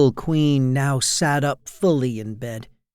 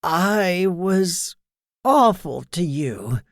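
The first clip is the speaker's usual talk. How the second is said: ordinary delivery, nothing marked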